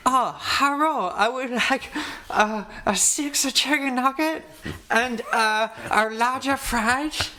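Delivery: high, slow mock Chinese accent, hammed up. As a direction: order fast food in an exaggerated fake Chinese accent. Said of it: "to do it in a Chinese accent".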